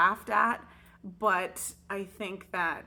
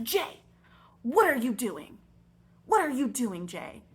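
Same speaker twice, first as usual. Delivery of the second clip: sharp, raised, accusing questions; angry teacher scolding; 2 semitones higher, 5 dB louder than usual. Retold or imitated. Teacher snaps at Jay for supposedly mocking her, angry. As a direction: call him out in front of the class.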